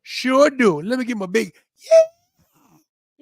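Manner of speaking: high, slow hammed-up country cheer; drawn-out, high-pitched country holler; country accent. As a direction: whoop it up like a country fan.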